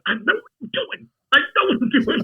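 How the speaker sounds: high, insistent retold protest; mock exasperated retort. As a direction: act out brushing off a nagging friend. Retold bickering with the friend.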